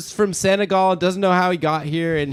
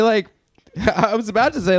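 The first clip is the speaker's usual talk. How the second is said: normal talking voice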